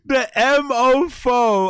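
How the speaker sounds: slow, high, loud mock of her; mocking mummy voice. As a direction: stretch it out like a prim mum. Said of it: "I love her mummy voice".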